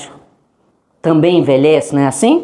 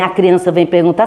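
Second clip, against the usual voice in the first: normal talking voice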